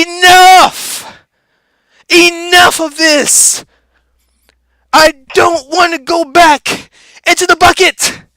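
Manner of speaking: loud, high defiant shout; shouting enough. Acted. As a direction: yell it out, breaking free. Crab breaking free shouting.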